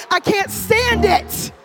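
loud exasperated preacher, stretching the last words; forceful preaching. Real, not acted. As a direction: preach it hard with drawn-out complaint. Preaching rises against religiosity.

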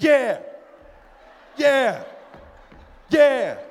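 loud, high, spaced-out yells; imitated full-voiced cheering; father's cheering shouts. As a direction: roar approval like a proud fight-side dad. Father cheering louder at the fight.

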